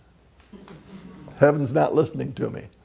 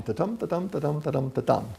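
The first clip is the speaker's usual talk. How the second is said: softer sing-song "ta-tum" with the tum stressed; iambic beat; 5 dB quieter than usual. Shown as a demonstration. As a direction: chant the five beats, hitting each tum. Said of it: "five of them in a line".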